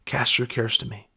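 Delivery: tender divine reassurance; gracious. Retold or imitated. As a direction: speak it gently with grace. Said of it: "the Lord just coming graciously saying".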